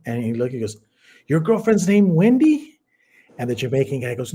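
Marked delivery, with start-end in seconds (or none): 1.3-2.8 higher, louder surprised rise on Wendy; surprised question. Retold or imitated. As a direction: ask it in disbelief. Jamaican asks, surprised.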